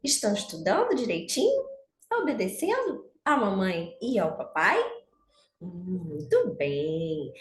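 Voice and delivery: put-on sing-song children's teacher voice; bright squeaky sing-song welcome. Teacher greeting children warmly. (saudação aguda e cantada para crianças)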